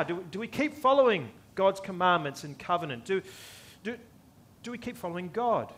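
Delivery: normal talking voice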